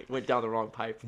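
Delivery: coughing then laughing, words strained; laughing through a choking cough. Real, not acted. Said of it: "I'm gonna let you cough".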